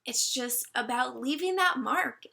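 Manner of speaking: usual speaking voice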